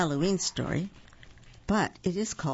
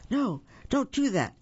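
high, urgent animals' plea; alarmed warning; 9 semitones higher than usual. A narrator's reading. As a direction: warn her off in mock alarm. Animals warn Mama Frog urgently.

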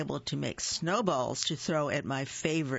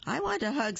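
little bird voice; 9 semitones higher, faster than usual. Acted.

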